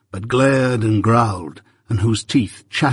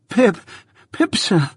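put-on frightened boy; 9 semitones higher than usual.

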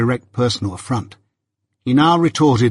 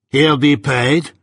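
slow, sullen sneer from a lout; surly Drummle; 2 semitones higher, slower than usual. A narrator's reading. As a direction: drag it out with contempt.

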